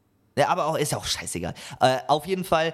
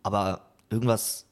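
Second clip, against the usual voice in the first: ordinary delivery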